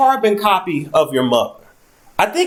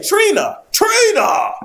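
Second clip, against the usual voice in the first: calling out; much slower than usual. Acted. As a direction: yell her name to call her back. Calling Trina back to office.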